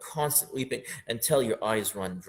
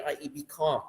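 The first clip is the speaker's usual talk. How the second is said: ordinary delivery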